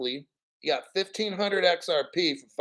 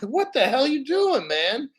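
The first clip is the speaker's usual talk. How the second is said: higher-pitched, incredulous retort; exasperated; 8 semitones higher than usual. Retold or imitated. Streamer retells confronting a viewer who never bought XRP, exasperated. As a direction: demand an explanation in mock exasperation.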